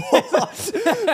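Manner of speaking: high burst of laughter. Real, not acted. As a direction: laugh out loud, high and bright. Laughing at giving it away.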